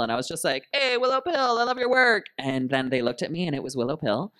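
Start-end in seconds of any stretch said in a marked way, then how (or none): 0.7-2.3 high, loud eager hello; excited fan call. Retold or imitated. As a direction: call out to your idol. Calling out to Willow.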